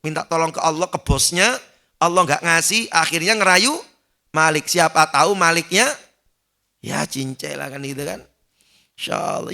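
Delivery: ordinary talk, nothing marked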